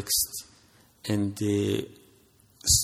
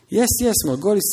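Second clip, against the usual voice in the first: higher, louder eager dad; eager father; 10 semitones higher, 4 dB louder than usual. Acted. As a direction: push him on with eager insistence. Excited father voiced.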